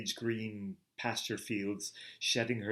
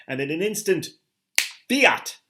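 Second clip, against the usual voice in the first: building up to a sudden high shout on fiat; rising into a loud, much higher exclamation; dramatic cry; 7 semitones higher, 10 dB louder than usual. A narrator's reading. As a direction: burst out with it like a thunderclap. Climactic moment of creation.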